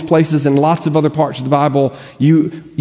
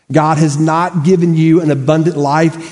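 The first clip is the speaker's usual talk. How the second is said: ordinary delivery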